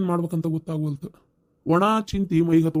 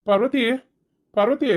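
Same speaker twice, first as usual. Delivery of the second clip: higher, rising name call; 6 semitones higher than usual. Acted. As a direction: summon someone from another room. Calling Parvati from another room. (ಕೂಗಿ ಕರೆಯುವುದು)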